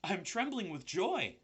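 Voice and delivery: high, excited happy voice; trembling with happiness; joyful pig. A narrator's reading. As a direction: Wilbur quivering with joy. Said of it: "said Wilbur".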